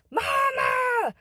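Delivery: very high, drawn-out cry for mother; child's yell. Retold or imitated. Terrified five-year-old yells for mom from bed, high-pitched. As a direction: re-enact a small child calling out for help. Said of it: "so finally I yell".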